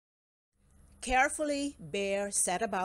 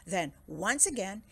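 usual speaking voice

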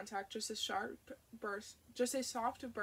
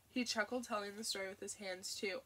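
ordinary delivery, nothing marked

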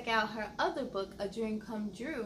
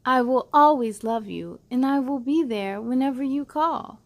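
louder, expressive picture book reading; reading to children; 10 dB louder than usual. Acted. Host reading a loving picture book to kids, expressive and louder. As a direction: read it out like a bedtime story for little ones.

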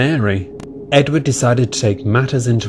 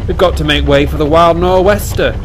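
higher, louder call over the engine; 6 semitones higher, 4 dB louder than usual. Acted. Fireman calling to driver about the express, raised. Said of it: "he called to the driver".

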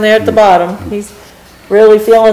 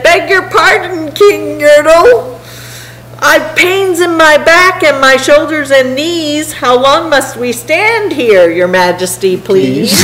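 higher-pitched polite complaint; meek turtle pleading with king; 8 semitones higher, slower than usual. A narrator's reading. Tired turtle at the bottom begs the king, higher pleading voice. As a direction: plead up to the king, sinking at the end.

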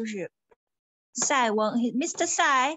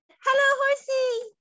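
delighted greeting; 10 semitones higher, 3 dB louder than usual. Acted. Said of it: "And Mister Sai said".

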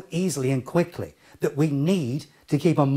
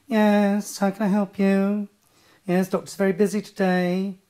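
put-on, sing-out receptionist voice on a high level note; doctor's receptionist voice; 4 semitones higher than usual. Receptionist telling a caller the doctor is busy, put-on high monotone. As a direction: parody an over-trained receptionist. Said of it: "a doctor's receptionist".

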